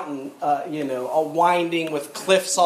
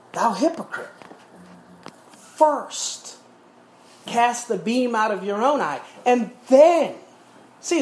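raised, ringing preacher's voice with rising fervour; preaching; 4 semitones higher than usual. Acted. Pastor preaching scripture to his church, fervent. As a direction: preach it out to the congregation.

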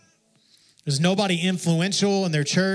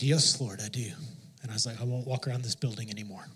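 quiet surrender; 6 semitones lower, 7 dB quieter, faster than usual. Retold or imitated. Retold surrender to God.

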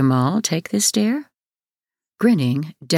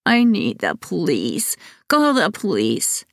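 1 crying plea; 3 semitones higher than usual. A narrator's reading.

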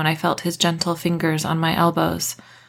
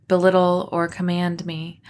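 ordinary delivery, nothing marked

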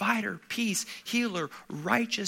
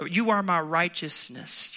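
ordinary talk

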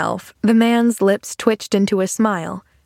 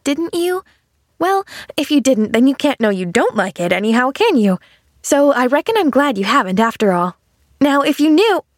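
put-on lilting, higher child's voice; eager little girl Pollyanna; 6 semitones higher than usual. Girl answering refusal of her jelly, eager chatter. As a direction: reason it out brightly as a chatty girl.

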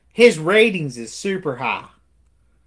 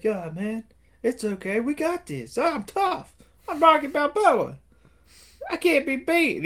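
mock cocky Rocky; 10 semitones higher than usual. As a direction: puff yourself up, squeaky and proud. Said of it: "He's like".

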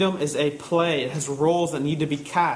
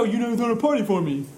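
quick, sing-song mock of the sulking son; father mocking the son's sulky complaint; mocking whine; 6 semitones higher, faster than usual. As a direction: parody the complaint, fast and dismissive. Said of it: "Don't sit out here and go".

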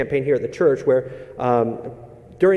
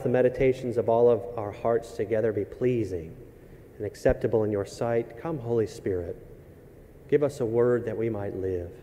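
prayer; 4 semitones lower than usual. Acted. Leads the opening prayer.

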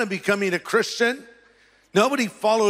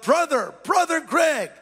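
yelled greeting; 9 semitones higher than usual. Retold or imitated. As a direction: hail him across the yard, higher. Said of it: "some guy that I didn't know yells out".

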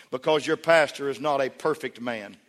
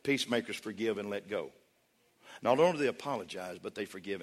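plain talk; 4 semitones lower, 6 dB quieter than usual. Real, not acted. Preacher closing with a plain word, quiet and direct. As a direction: lower and softer, straight to them. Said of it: "talk to you real plain now".

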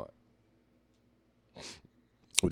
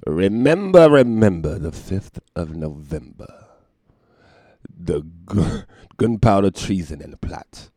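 loud, slow theatrical verse; dramatic recitation; 10 dB louder than usual. Retold or imitated. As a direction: recite it like a masked revolutionary. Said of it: "watching V for Vendetta".

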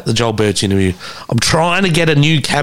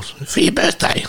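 higher whispery imitation of mum; mock mum, pitched up and breathy; mum's voice; 13 semitones higher, 3 dB quieter than usual. Mum on the phone about his present, mock high breathy voice. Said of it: "She's like".

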